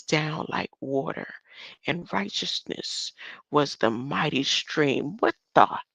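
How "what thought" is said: ordinary delivery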